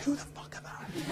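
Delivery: hushed, baffled whisper; whispered aside. Retold or imitated. New student's private thought in class, baffled whisper. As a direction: whisper it under your breath.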